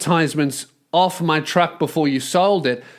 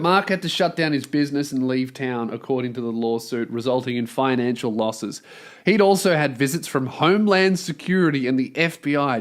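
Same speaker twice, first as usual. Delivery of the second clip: lower, even reading of the report; news article read; 6 semitones lower, 4 dB quieter than usual. A narrator's reading. Host reads the news story to listeners, dry lower read. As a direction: read the lawsuit report straight.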